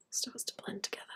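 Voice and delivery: hushed echo of her own words; whisper. Acted. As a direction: breathe it out as a whisper. Whispered echo of blend line.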